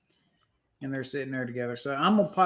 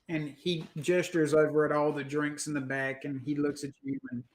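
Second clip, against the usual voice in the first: usual speaking voice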